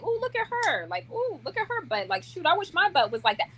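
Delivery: high-pitched, appreciative ooh-ing; admiring exclamation. Retold or imitated. Woman pointing out a beautiful woman to her man, admiring exclamation. Voicing admiring remarks about women.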